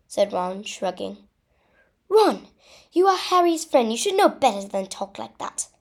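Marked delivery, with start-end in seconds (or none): 2.1-5.7 high, loud telling-off, easing toward the end; indignant yell that settles as it goes; yelled scolding. A narrator's reading. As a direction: scold a friend sharply with a raised voice. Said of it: "Hermione yelled at Ron".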